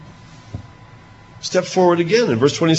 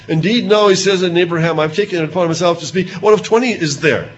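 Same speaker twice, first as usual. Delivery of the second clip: high, louder, urgent bargaining; Abraham bargaining; 5 semitones higher, 6 dB louder than usual. Acted. Abraham haggling again with God, in a high urgent voice. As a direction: push the price down boldly.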